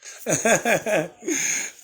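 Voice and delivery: high, real laugh. Real, not acted. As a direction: burst out laughing at the memory. Laughs at own story.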